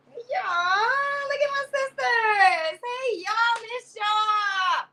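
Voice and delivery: high, excited hey y'all to the sisters; squealing hello to friends; excited high greeting. Real, not acted.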